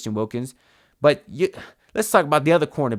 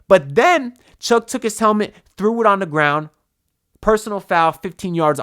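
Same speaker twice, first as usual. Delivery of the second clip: higher, louder angry outburst; venting at the ruined play; real anger rising; 5 semitones higher, 4 dB louder than usual. Real, not acted. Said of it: "Oh, that got me so mad".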